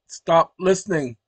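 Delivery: slow, loud command to the assistant; loud, deliberate stop command; firm command. Real, not acted. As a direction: say it slowly and firmly so the phone obeys. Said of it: "slight misunderstanding".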